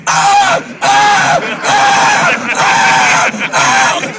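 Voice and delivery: very loud, high parrot screech. Retold or imitated. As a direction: screech like a hungry macaw. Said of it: "he'll say".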